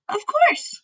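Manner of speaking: put-on a little girl answering brightly; Sophie's voice. A narrator's reading.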